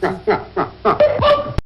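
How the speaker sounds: high, bursting cackle; helpless laugh. Real, not acted. Cracking up at the story.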